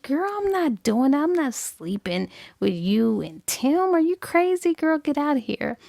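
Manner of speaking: high-pitched, incredulous brush-off; scandalized refusal. Acted. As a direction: refuse your friend in acted disbelief. Friend refuses the threesome proposal.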